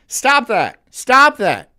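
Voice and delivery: loud, high scolding command. Real, not acted. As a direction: tell them off sharply and loudly. Scolding the woman in the clip.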